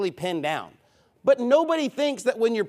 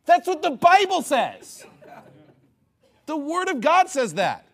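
slow, high, indignant cry; raised voice, drawn-out and insistent; emphatic preaching shout; 8 semitones higher, 3 dB louder, slower than usual. Real, not acted. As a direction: hammer each word at the critics. Shouting back at critics.

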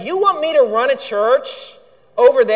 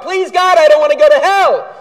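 mock pleading; 7 semitones higher, 10 dB louder than usual. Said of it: "There's nothing about".

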